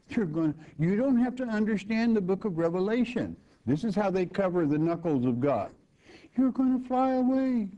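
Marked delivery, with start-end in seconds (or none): 0.1-3.3 higher, faster mock of a false teacher's claim; mocking false preacher. Preacher mocking false teachers' easy promise, higher and faster. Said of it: "a man that would tell you". 6.4-7.7 much higher, sing-song mock of a false promise; mock rapture preacher. Preacher mimics rapture teachers promising flight, much higher mock.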